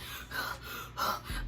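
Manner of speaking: heavy imitated huffing breaths; mimicked winded breathing; out-of-breath panting. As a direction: pant like you just ran in. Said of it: "out of breath and shit".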